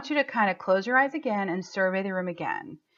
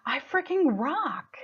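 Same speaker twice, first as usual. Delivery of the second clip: slow, drawn-out boast, pitched high; self-celebrating; 6 semitones higher, slower than usual. Acted. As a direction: relish each word as you cheer yourself on. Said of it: "there's a part of me that's like".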